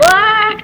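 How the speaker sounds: sudden high yelp; startled yelp. Real, not acted. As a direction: yelp in surprise. Startled in game.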